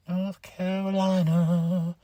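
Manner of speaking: "North Carolina" slow and stretched, announcer-style; drawn-out announcer. Shown as a demonstration. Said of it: "Wade Joe on commentary in North Carolina".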